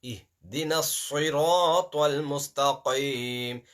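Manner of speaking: slow recitation with a thick sirat. Shown as a demonstration. Teacher slowly modelling the verse for students. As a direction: draw it out slowly. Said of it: "This is not ha".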